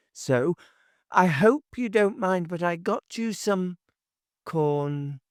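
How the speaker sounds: put-on high, fussy gossiping old woman; chattering busybody neighbour. A narrator's reading. Neighbour chattering to Oshiva about his shopping, high and fussy.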